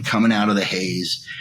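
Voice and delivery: normal talking voice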